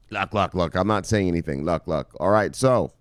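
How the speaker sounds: ordinary talk, nothing marked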